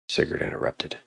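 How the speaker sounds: ordinary delivery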